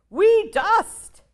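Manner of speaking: very high, loud little goblin voices. Acted. Four wee goblins answer their pa, high and loud. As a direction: chirp it back like tiny goblins. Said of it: "said the four".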